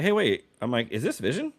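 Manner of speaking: retold double-take, pitched high; high, rising incredulous query; puzzled disbelief. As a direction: act out a suspicious, high-pitched question. Suspects the AI voice is wrong.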